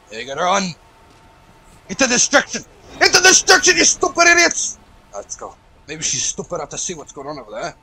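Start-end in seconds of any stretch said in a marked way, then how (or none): 1.9-4.8 mock battle cry. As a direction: yell it like a cartoon villain charging in. Yelling at game enemies.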